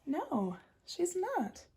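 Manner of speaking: ordinary delivery, nothing marked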